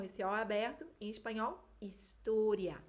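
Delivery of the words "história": história, slow, with a closed O; Spanish closed O. Shown as a demonstration. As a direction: stretch história and keep the O closed. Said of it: "História com O fechado". (história devagar, com O fechado)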